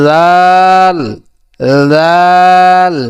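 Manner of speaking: touch tongue to upper teeth and stretch জাল; tongue-tip Arabic zal. Shown as a demonstration. Teacher repeats জাল for learners, dental buzz, drawn out. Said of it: "আগার সঙ্গে লাগাইয়া".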